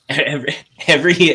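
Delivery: normal talking voice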